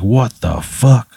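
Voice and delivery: mildly angry, drawn-out disbelief; slow, stretched curse of a man just robbed; upset man. Acted. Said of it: "you hear the man's voice".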